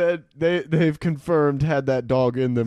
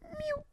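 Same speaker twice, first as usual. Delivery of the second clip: small, soft, high kitten mew; kitten's mew; 14 dB quieter than usual. Retold or imitated. Showing a friend the kitten's cry, soft high mew. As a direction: squeak out a tiny mew. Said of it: "not quite meowing, but just like".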